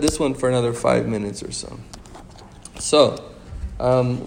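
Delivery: ordinary delivery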